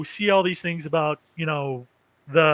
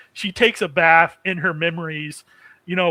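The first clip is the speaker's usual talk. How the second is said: normal talking voice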